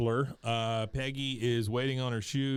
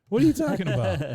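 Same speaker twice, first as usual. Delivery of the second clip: higher, louder, fast disbelief; mock outrage; 7 semitones higher, 8 dB louder, faster than usual. Real, not acted.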